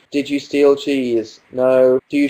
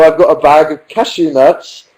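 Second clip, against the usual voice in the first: ordinary talk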